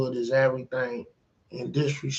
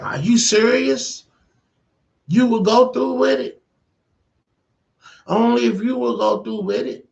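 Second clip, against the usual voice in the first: high, loud disbelieving questions; incredulous; 9 semitones higher, 5 dB louder than usual. Retold or imitated. Husband stunned his wife would take the deal, high incredulous. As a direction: push it up in shock.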